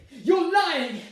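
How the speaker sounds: high, loud outburst; furious accusation. Acted. Bride accusing groom of lying, furious. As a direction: fling the accusation at him.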